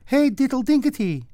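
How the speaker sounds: very high squeaky mice; tiny mouse voices. A narrator's reading. Little mice answering the cat, very high squeak. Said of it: "answered the little mice".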